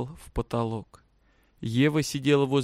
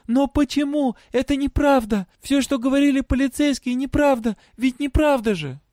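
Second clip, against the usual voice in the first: high-pitched, pleading protest; distressed girl; 12 semitones higher, 4 dB louder than usual. A narrator's reading. Girl disbelieving her father's rejection, distressed.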